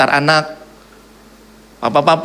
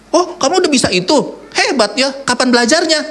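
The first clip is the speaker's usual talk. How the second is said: higher, mock amazed father; surprised father; 10 semitones higher than usual. Father amazed his child learned something, mock surprise. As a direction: gush over what the kid can do, pitched up.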